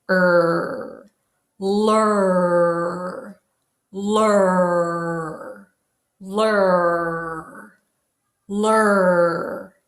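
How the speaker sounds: repeated lur, tongue sliding back; L to R drill. Shown as a demonstration. Teacher drills L into R for the class. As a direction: chant lur lur lur, L into R. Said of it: "change it by pulling your tongue back".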